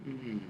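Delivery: soft mm-hmm dropping in pitch; falling mm-hmm demo; falling hum. Shown as a demonstration. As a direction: let the hum fall and soften. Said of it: "allow the sound to come down".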